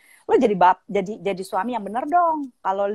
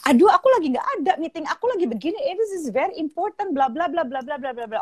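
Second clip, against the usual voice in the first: lightly voiced busy wife, raised pitch, dismissive; busy wife brushing off; 7 semitones higher than usual. Husband imitates his busy wife waving him away, mock dismissive. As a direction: toss it off like she has no time. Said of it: "Misalnya dia bilang gini".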